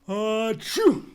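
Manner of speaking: big, drawn-out mock sneeze; hammed-up sneeze; loud fake sneeze. Voicing a character sneezing.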